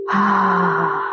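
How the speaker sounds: long relieved "ah"; easy, relaxed sighing ah; sigh of letting go. Shown as a demonstration. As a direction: release the breath on a drawn-out ah. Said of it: "come to a feeling of".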